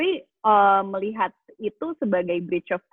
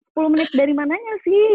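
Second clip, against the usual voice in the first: quick, higher, louder retort of exasperation; mock annoyed; 6 semitones higher, 5 dB louder, faster than usual. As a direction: complain in playful exasperation at being kept waiting. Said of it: "Kan lo kesel kan". (pura-pura kesal, cepat dan meninggi)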